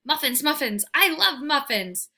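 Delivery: loud, eager muffin chant, slowing; bouncing with greed for muffins; excited dog. Acted.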